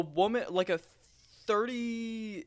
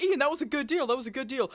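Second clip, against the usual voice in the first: higher mock teasing; teasing coworkers; 7 semitones higher than usual. Coworkers tease the cashier, higher mock tone. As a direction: rib him like a coworker. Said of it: "everyone in the store is like".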